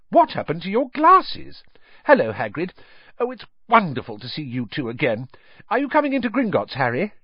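put-on high, fast, eager girl's voice; bubbly, rushing greeting; excited girl.

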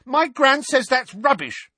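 very high, loud interjection; high-voiced, emphatic butting in; piping up. A narrator's reading. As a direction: pipe up bravely. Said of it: "piped up Neville".

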